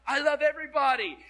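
mock gushing exclamation. Preacher acting out his own thought.

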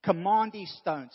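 "Command" slow, loud and stretched; stress on command. Shown as a demonstration. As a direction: lean hard on "Command" like a decree. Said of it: "Take a thought and say it".

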